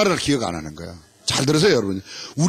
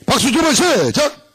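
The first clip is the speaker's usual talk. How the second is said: game command call; 8 semitones higher, 4 dB louder, slower than usual. Shown as a demonstration.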